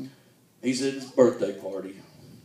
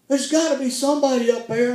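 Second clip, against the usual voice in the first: high, louder call up to the sky; mock desperate call; 12 semitones higher, 5 dB louder than usual. The stranded man shouts up to heaven, in mock desperation. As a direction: plead upward, half-joking panic.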